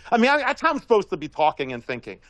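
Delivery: usual speaking voice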